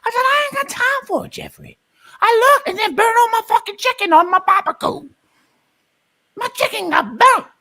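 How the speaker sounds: high, loud sassy barbecue auntie. Acted. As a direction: shout it high like a fed-up auntie at the grill.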